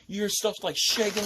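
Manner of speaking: ordinary delivery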